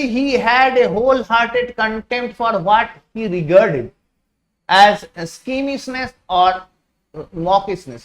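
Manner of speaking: very slow, careful reading; slow reading aloud. Shown as a demonstration. Teacher reading the memoir aloud to students, slow. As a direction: read it out word by word. Reading the English text slowly.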